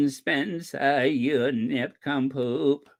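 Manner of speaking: slow, quavering actress impression; hammed-up tremulous old-Hollywood voice; Katharine Hepburn. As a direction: wobble through every drawn-out word. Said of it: "we're all gonna talk like that".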